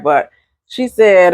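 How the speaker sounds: normal talking voice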